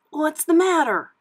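high, loud questioning pup; puppy Scamp. A narrator's reading. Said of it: "Scamp asked".

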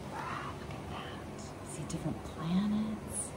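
hushed wondering whisper. Real, not acted. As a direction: whisper in quiet awe. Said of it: "aside to listener".

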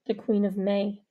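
the Queen of May, said low; lightly voiced low man; low male character voice. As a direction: drop to Avery's deep voice.

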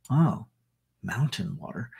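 normal talking voice

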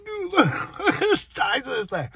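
usual speaking voice

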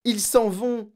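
high, loud urgent outcry; girl shouting in alarm; distressed cry. A narrator's reading. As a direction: cry out the terrible news. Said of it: "cria-t-elle".

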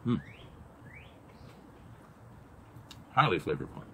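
chewing while talking; muffled by food; mouth full. Real, not acted. Seen 0: Bites sardine at -5, cheeks full; lips working while murmuring after.

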